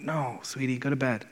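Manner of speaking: put-on softly, patiently telling a child no; gentle refusal. Dad gently sending his daughter back to bed, soft and patient. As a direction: hush her back to bed kindly.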